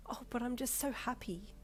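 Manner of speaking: high, soft delight. A narrator's reading. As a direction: gush it like a thrilled mum. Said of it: "with a delighted chuckle".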